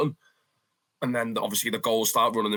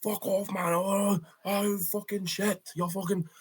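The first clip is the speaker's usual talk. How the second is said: higher, strained mock outrage at the goals; imitating furious fans; 9 semitones higher, slower than usual. Home fans cursing each goal, higher mock outrage. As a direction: play the groaning home fans swearing. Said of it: "every time, everyone".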